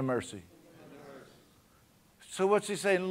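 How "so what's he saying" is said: loud, high, impassioned preaching; full-force preaching. Acted. Pastor fires up the church about sin and mercy, loud high preaching. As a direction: build it to a shouting climax.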